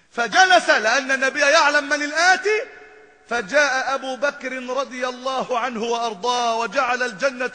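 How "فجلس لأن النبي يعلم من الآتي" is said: ordinary talk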